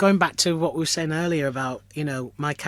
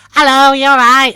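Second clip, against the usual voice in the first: very high, loud comic greeting; squeaky full-blast panto character; 12 semitones higher, 17 dB louder than usual. Shown as a demonstration. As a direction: shriek a cheery hello as Wishy Washy. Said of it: "And I put the voice on".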